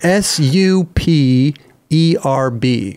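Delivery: slow letter by letter; spelling it out. Shown as a demonstration. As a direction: spell each letter slowly, clearly. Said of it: "do you want me to spell it for you".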